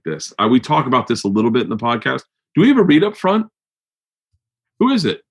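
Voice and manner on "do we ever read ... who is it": high, puzzled asking; surprised question. Real, not acted. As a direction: ask suddenly, pitch way up. Asking producer, high pitch.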